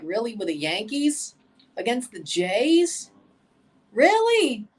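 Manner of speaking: incredulous, rising to a high, loud final really; exasperated disbelief. Retold or imitated. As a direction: groan it out like a betrayed fan. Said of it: "but I'd be like".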